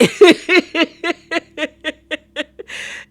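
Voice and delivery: high, delighted burst of laughter; real laugh. Real, not acted. Reacting to Mary's cute confession, real laugh. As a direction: laugh out loud with joy.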